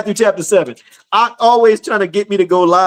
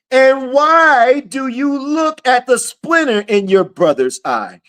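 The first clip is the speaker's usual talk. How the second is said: slow, raised, ringing sermon read; preaching scripture; 7 semitones higher, slower than usual. Acted. Preacher proclaiming Matthew seven to his livestream, slow and ringing. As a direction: proclaim the verse to the congregation.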